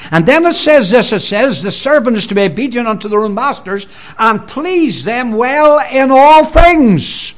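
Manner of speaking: full-force sermon reading, slowing at the end; fervent preaching. Acted. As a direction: proclaim the verse to the hall. Preaching the scripture text.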